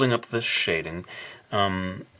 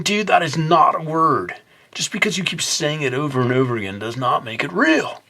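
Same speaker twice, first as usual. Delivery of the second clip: mock skeptical friend; 6 semitones higher than usual. Voicing a friend who rejects his made-up word, teasing scold. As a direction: nag him like an exasperated buddy.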